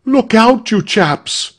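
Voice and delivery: high-pitched warning cry; frightened. A narrator's reading. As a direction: call out an alarmed warning. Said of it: "interrupted by a frightened voice".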